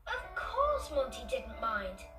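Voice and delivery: usual speaking voice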